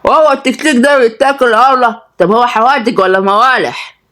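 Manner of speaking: put-on greedy kid asking about food; boy talking while eating. A narrator's reading. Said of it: "رد عبد المجيد وهو بياكل". (ولد بيتكلم وهو بياكل)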